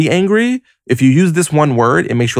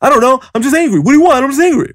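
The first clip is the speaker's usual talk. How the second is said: much higher, louder, petulant mock voice; mock whiny angry reply; 4 dB louder, faster than usual. Angry person asked why they're angry, answering in a much higher mock whine. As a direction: whine it like a sulky kid who just is angry. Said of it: "And you go".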